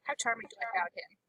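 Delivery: unvoiced whisper aside. Real, not acted. Player whispering a question about the helper. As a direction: whisper it out of character.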